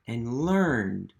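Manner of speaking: usual speaking voice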